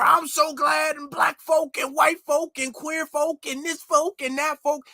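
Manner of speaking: high-pitched mocking rally cadence; hammed-up imitation of a speechmaker; mock fiery speechmaker. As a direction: parody a preacher-style politician working the crowd. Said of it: "fake, uh, Martin Luther King accent".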